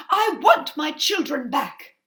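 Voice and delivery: high and loud demand; insist, unwilling to leave. Acted. Mother demanding her children from the witch, loudly.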